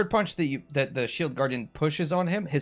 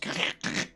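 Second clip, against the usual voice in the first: crackling crunch made in the mouth; bone-knitting noise; 6 dB quieter than usual. Retold or imitated. Game master showing bones mending, imitated crackle. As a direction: imitate bones grinding back into place. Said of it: "you hear the bones".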